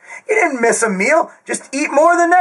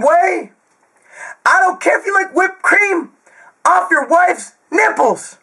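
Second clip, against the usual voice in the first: screaming rant; 4 semitones higher than usual. Real, not acted. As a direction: yell it at the viewers like a fired-up coach. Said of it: "why are you screaming, [name]".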